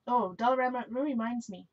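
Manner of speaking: usual speaking voice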